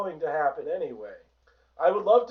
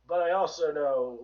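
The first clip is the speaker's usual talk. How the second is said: ordinary delivery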